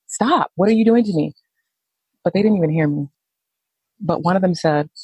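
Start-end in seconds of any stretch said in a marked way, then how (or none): 0.1-1.3 higher-pitched protesting cry; mild re-enacted yell, pitched up; retold yell. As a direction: protest in alarm, voice jumping up. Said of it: "like, I'm yelling".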